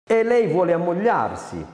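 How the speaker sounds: scornful. A narrator's reading. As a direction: throw it at him with contempt. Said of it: "disse Carina con disprezzo".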